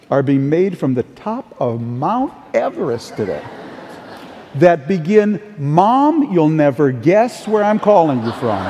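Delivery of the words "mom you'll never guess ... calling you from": mock-thrilled caller with big news for mom; gleefully calling Mom from somewhere amazing; excited call home. Acted excited phone call to mom.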